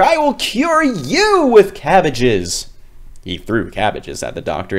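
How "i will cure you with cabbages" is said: high, louder and slow menacing threat; furious, slow warning; angry father. Acted. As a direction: spit the threat at the doctors. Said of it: "her angry father".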